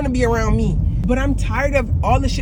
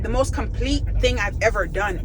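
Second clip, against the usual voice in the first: usual speaking voice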